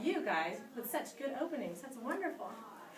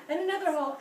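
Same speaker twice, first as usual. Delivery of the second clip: loud, punchy storyteller line; dramatic telling; 4 semitones higher, 7 dB louder, faster than usual. Acted. Scaring the class with the tooth story, loud dramatic line. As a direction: hit it like a horror beat.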